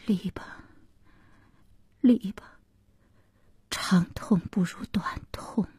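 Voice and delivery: soft, breath-heavy giving up; quiet, weary decision; hushed resignation. Acted. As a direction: let the marriage go in a near whisper. Resigned consent to the divorce.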